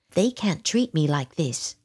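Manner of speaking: usual speaking voice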